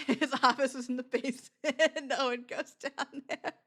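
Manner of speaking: giggling. Real, not acted. Joking about coworker.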